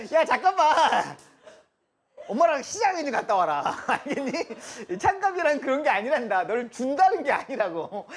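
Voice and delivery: put-on high, loud, slowed scolding of a child; mock mom scolding. Teacher mimicking a mother scolding a child, high and loud. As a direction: play a fussing mother correcting her kid.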